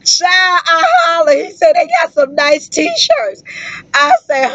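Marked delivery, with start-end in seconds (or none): 1.8-3.4 repeats it high and slow, cracking up with laughter; high, slow line that breaks into laughter; laughing. Retold or imitated. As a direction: squeal the line through helpless laughter. Repeating his line while laughing.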